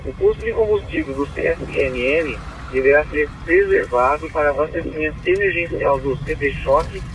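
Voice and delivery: crackly two-way radio transmission; squelchy handheld radio; police radio. Real, not acted. Dispatcher reads order over radio.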